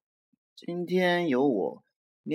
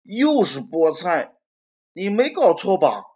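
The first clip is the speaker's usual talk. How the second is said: louder, higher indignant outcry; yelling at dad; 4 semitones higher, 8 dB louder than usual. Acted. Robert yells at dad about the spinach, indignant loud. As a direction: complain loudly, dragging it out. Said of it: "于是他冲着爸爸叫道".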